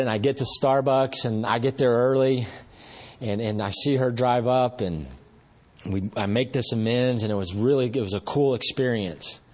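ordinary delivery

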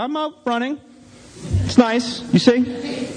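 light, high and casual, hiding the chase; breezy cover-up. Pretend. Telling mom on the phone all is fine, breezy and casual. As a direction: sound carefree while running from dogs.

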